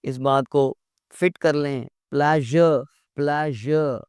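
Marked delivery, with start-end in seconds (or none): 2.1-4.0 प्लेजर with zh, never like player; right pronunciation. Shown as a demonstration. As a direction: say प्लेजर slowly with zh, not y. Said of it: "प्लेयर नहीं".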